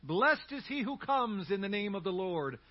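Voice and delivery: loud, high acclaim, easing after the first cry; acted shout of praise; crowd shouting. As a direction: call it out like a cheering crowd. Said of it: "they begin shouting".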